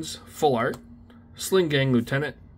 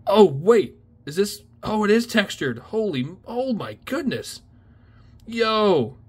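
high, breathless rising excitement; thrilled discovery; 8 semitones higher than usual. Real, not acted. Spotting the textured foil on his new card, thrilled. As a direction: let the surprise take over your voice.

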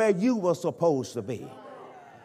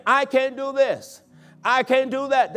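mock discouraged whining; 6 semitones higher, 3 dB louder than usual. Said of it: "When I was walking around saying".

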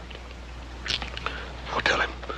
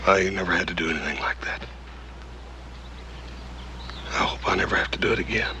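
shaken whisper; 4 semitones lower, 3 dB quieter, faster than usual. Acted. Shaken after killing a man.

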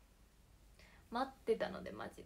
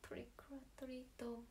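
whispering; 11 dB quieter than usual. Pretend. Whispered lottery call.

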